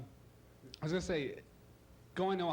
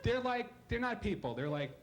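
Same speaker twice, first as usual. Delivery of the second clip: ordinary talk, nothing marked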